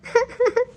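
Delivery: short high sob; wife crying over her lonely life, short sob. Acted. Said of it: "ಯಾಕೆ ಅಳ್ತಾ ಇದ್ದೀರಲ್ಲ ನೀವು?".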